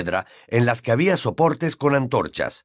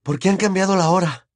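out of breath; 8 semitones higher than usual. Acted. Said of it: "casi sin aliento".

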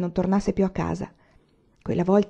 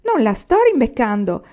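higher and louder, insisting; shrill protest; 5 semitones higher, 6 dB louder than usual. Acted. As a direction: cry out again in self-defence. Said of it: "strillò di nuovo Grace". (bambina che strilla per difendersi, più acuta e forte)

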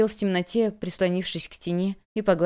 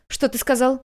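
shocked; 6 semitones higher than usual. A narrator's reading. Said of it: "Она подскочила".

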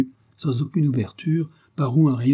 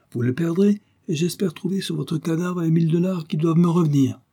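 higher-pitched, lightly amused menace; gleeful taunt; 6 semitones higher than usual. A narrator's reading. Captor expects the money off the detective's corpse, gleefully taunting. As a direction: gloat with a touch of glee. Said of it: "cria Thompson en s'esclaffant".

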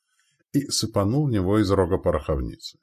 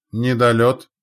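loud, high spotter's shout; short battlefield cry; called-out report; 5 semitones higher, 7 dB louder, slower than usual. A narrator's reading. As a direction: call the fall of shot to everyone. Observer calls the enemy shot.

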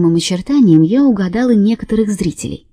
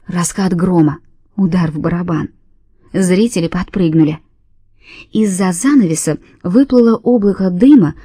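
plain reading voice

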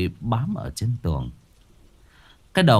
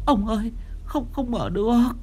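panicked wife; 12 semitones higher than usual. Acted.

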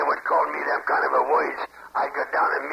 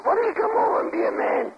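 high, heated challenge to come and fight; angry challenge. Real, not acted. Barman challenges the prank caller to come fight, angry. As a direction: dare him to show up, furious.

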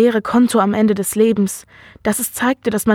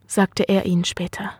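plain reading voice

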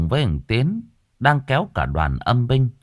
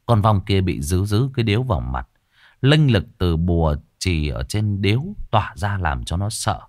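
plain reading voice

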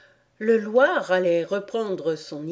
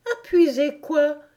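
high, quick puzzled Alice; surprised question; 9 semitones higher, 5 dB louder than usual. A narrator's reading. Alice breaking her promise, high and curious. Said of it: "demanda Alice".

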